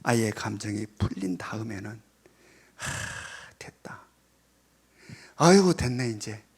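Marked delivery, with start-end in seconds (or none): 2.8-6.4 relieved sigh. Retold or imitated. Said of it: "팽개쳐두지 말라".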